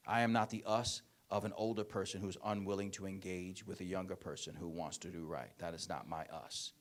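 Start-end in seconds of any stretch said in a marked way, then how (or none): none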